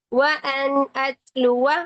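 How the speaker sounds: व अन अतलुवा slow, final alif silent; careful slow व अन अतलुवा; Qur'anic recitation. Shown as a demonstration. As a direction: recite व अन अतलुवा without a long ending. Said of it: "यहाँ पर भी अलिफ को खत्म करेंगे".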